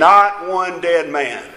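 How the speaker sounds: "Not one dead man" very slow, each word weighted; emphatic repetition. Real, not acted. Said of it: "say to you emphatically, without any hesitation".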